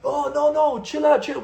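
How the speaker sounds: loud, high, hurried reassurance; imitated placating host. Club fixer placates angry tourists, high and urgent.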